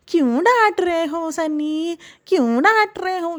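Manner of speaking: high, drawn-out wounded whine; mocking whiny wife. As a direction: mimic a wife complaining about being scolded, stretching the words. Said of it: "ये ऐसे ही बोलती है". (बीवी की रिरियाती, खिंची हुई आवाज़ की नकल)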